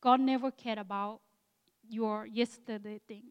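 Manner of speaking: ordinary talk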